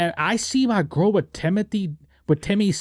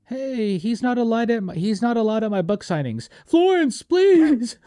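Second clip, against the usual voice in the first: mock pleading; 7 semitones higher than usual. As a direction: beg her in a squeaky, desperate voice. Acting Timmy begging Florence, mocking.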